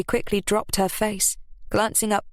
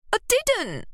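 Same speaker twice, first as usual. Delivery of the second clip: high sharp retort; shot back. A narrator's reading. Ruby snapping back at Lily's pushing. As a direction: fire it back at her. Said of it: "Ruby shot back".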